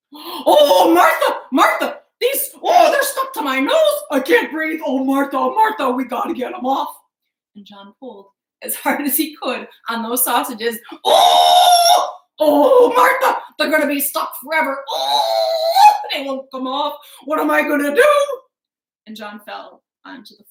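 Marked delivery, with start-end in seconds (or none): 0.1-7.0 high, loud panicked cries; desperate to get them off; frantic, nearly laughing panic; panicked husband. Acted. Sausages stuck to nose, panic. 11.0-18.4 high, loud panicked cries; desperate to get them off; frantic, nearly laughing panic; panicked husband. Acted. Sausages won't come off.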